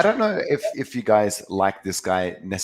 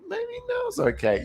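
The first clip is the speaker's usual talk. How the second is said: very high, slow squeaky pet voice; pet's sneaky thought, even higher; slower than usual. Retold or imitated. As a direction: push the pet voice way up, slow and coaxing. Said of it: "It'll be like".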